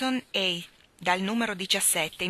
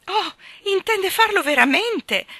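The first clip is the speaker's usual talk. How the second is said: very high, loud dismayed cry; 13 semitones higher, 10 dB louder than usual. A narrator's reading. Miss Lark realising her dog means to go, crying out. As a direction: cry out loudly in disbelief. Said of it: "gridò Miss Lark".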